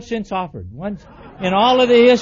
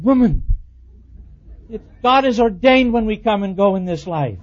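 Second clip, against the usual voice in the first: loud, higher preachy scolding; hammed-up lecture; 3 semitones higher, 7 dB louder than usual. Husband loudly lecturing his wife to trust God. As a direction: lay down scripture at her.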